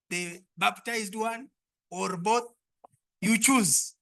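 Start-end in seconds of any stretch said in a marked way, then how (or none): none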